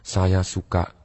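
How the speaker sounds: low, soft, slow with pauses; feeling-type speaker. Shown as a demonstration. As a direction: speak low and unhurried, as if feeling each word. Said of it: "Dia menggunakan ciri-cirinya". (nada rendah, pelan, penuh jeda)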